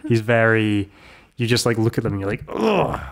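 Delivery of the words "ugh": high exasperated "ugh"; acted groan of distaste; disgusted groan. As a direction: groan in mock loathing. Acts out disgust at hated character.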